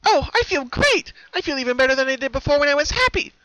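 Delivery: very high, loud squealing joy; very high gleeful squeal; giddy excitement. Acted. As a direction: bounce with delight. Pinkie voiced, joyful.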